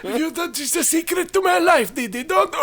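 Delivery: put-on very high, rising panicky old man; frantic old grandpa. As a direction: hysterical grandpa guarding a treasure. Voicing the grandparent.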